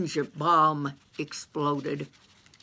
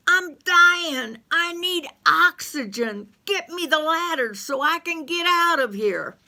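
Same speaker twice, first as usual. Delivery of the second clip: high, loud, desperate squeal; frantic Carl; 11 semitones higher, 4 dB louder than usual. Acted. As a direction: wail it, gasping for air.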